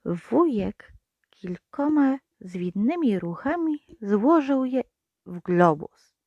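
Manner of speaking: usual speaking voice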